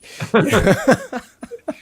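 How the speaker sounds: loud, high burst of laughter; big laugh. Real, not acted. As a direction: crack up at your own joke. Laughs at own joke.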